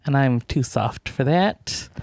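usual speaking voice

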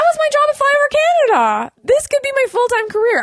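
pep it up like a spokesperson; high peppy voice. Real, not acted. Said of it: "Your voice just went up, like, an octave".

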